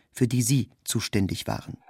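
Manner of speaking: usual speaking voice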